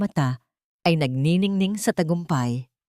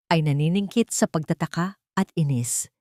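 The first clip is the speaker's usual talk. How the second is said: ordinary delivery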